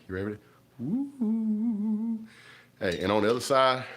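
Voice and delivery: usual speaking voice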